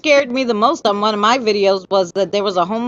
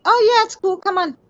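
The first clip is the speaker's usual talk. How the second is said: airy high voice, much higher; breezy woman; 9 semitones higher than usual. Retold or imitated. Woman in the lounge chair inviting her in, high breezy voice. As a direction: wave them in casually. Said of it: "She's like".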